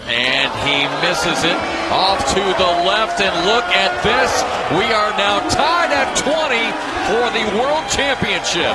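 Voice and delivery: high, loud tied-game call; excited shout. Real, not acted. Commentator roaring as the world final ties, excited shout.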